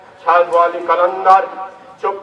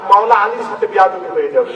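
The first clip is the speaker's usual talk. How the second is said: ordinary delivery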